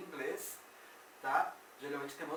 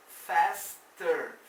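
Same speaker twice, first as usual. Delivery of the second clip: "faster" broken into loud "fas" and "ter"; loud, slow two-piece "faster"; split syllables; 9 dB louder than usual. Shown as a demonstration. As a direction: punch both halves of "faster" with a gap. Teacher separates stem from ending.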